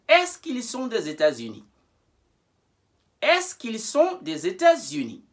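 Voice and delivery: "Est-ce qu'ils" joined with elision, no pause before "ils"; model question with est-ce que. Shown as a demonstration. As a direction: clear model reading, "Est-ce qu'ils" said as one joined sound. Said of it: "now we want to say".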